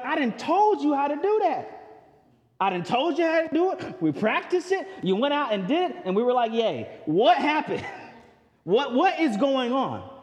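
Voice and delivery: high, exasperated scolding of the disciples; frustrated Jesus. Retold or imitated. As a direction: play an exasperated teacher who already showed them how. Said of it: "His frustration is pointed to his disciples".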